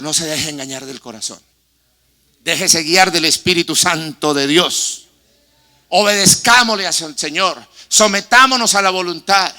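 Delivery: loud, high, slow impassioned prayer; fervent preaching prayer. Acted. Pastor leading the church in prayer, loud fervent crescendo. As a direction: cry out to God over the congregation.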